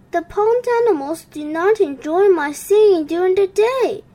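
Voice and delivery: a heartbroken frog pouring out his woe; mild storybook wail. A narrator's reading. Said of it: "he wailed".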